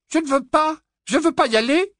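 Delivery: very high, very loud bitter refusal; furious refusal. A narrator's reading. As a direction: shriek it at your sister. (Pétunia refuse en criant, très aiguë)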